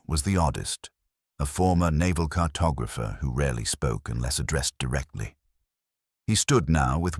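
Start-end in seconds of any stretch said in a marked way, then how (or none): none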